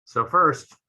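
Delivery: ordinary talk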